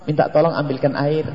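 polite, low-key asking; gentle request. Acted. Husband asking wife to fetch water, gently. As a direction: ask softly and courteously for help. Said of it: "Lihat dengan bahasa lembut".

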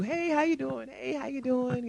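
a breezy church-lobby hello; church greeting. Retold or imitated. Church member greeting a newcomer before service, breezy sing-song.

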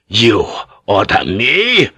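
slow, incredulous challenge swooping upward; affronted officer's drawn-out question; indignant disbelief. Acted. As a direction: throw the order back in disbelief. Officer outraged at being ordered.

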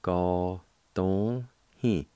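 "go, don, he" with each syllable separated; "go, don, he" slowly with pauses between syllables. Shown as a demonstration. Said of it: "Second part".

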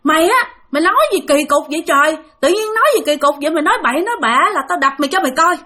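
quick mock-angry threat to hit him; scolding threat. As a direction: threaten him like a cross older sister. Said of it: "mẹ mới la".

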